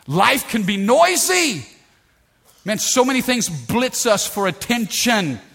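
high, loud burst of preaching; impassioned preaching shout. Real, not acted. Preacher warning the church how noisy life is, impassioned. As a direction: cry it out over the noise.

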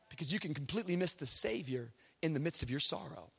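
soft, hushed pastoral appeal; gentle preaching. Real, not acted. Preacher urging mourners to move into today, soft and earnest. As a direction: speak softly and earnestly to the grieving.

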